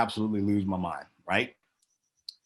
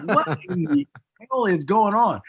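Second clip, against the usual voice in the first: much higher, stunned question; shocked; 11 semitones higher, 4 dB louder than usual. Retold or imitated. Speaker backstage hearing the crowd chant, high and shocked. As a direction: react in disbelief to the noise.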